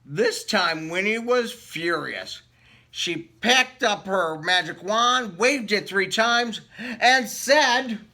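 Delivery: lively read-aloud storybook voice; story narration. Acted. Reading a picture book to children, lively storytelling voice. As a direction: read it like bedtime story time.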